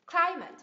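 CLI in "Climate" high and strong, then falling; first-syllable stress. Shown as a demonstration. Teacher models Climate for learners, first syllable stressed. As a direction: hit the first syllable of "Climate", drop the second. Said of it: "Nhấn âm tiết thứ nhất".

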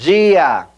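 DIA said "jee-a"; Portuguese pronunciation. Shown as a demonstration. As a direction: model DIA slowly for repetition as "JEE-ah". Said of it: "ahora hagamos el backtracking".

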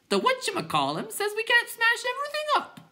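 very high squawky clown; clown creature voice. A narrator's reading. Clown informing the other clowns, very high voice. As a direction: pipe it out in a silly high voice.

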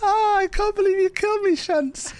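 very high-pitched, hammed-up crying complaint; mock wail. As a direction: whine it like a heartbroken victim, badly overdone. Mock dismay after being killed.

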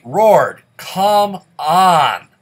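loud exasperated yell at the game; frustrated shout. Real, not acted. Frustrated at a failing hero.

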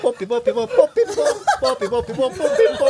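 high, laughing sing-song call to a dog. Real, not acted. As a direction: coax the puppy over, playfully. Calling the dog playfully. (강아지 부르는 높은 목소리)

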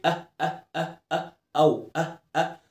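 short, soft "اه" repeated as separate glottal vowels; isolated first sound. Shown as a demonstration. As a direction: pick out "اه" alone, the opening sound of "اور". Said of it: "اول صوت في كلمه ايه". ("اه" قصيرة منفصلة بهمزة)

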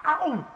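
a loud high yelp of pain; sudden loud ow; sharp cry of pain. Acted.

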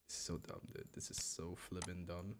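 breathy whisper of defeat. Real, not acted. Streamer whispering in defeat at the game. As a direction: mutter it under your breath.

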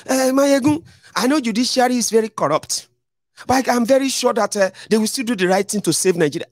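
high, earnest mock pleading; hopeful follower. Follower telling Mayegun the courts will help: high, naive. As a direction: play the follower who still trusts the courts.

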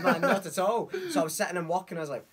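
usual speaking voice

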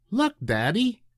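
put-on high child's voice, eager; little boy. A narrator's reading. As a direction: point it out to daddy.

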